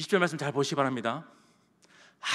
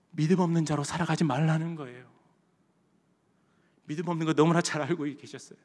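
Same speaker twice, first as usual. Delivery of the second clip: normal talking voice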